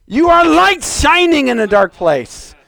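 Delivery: high, slow proclamation; full-force preaching. Real, not acted. Preacher telling saints they are light, impassioned proclaiming. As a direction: ring it out slowly over the congregation.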